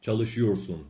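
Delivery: ordinary talk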